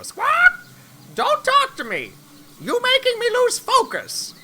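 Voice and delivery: high squawking rooster. Acted. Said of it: "I'm gonna do this rooster voice".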